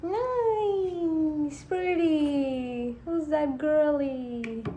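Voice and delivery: playful cutesy mirror talk; cutesy self-praise. Acted. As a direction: coo at your own reflection. Admiring herself in mirror.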